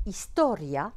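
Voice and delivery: historia stressed on TO, ia run together; "historia" with diphthong ending; no hiatus. Shown as a demonstration. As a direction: glide the end of historia as one syllable, his-TO-rya. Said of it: "pas de diérèse".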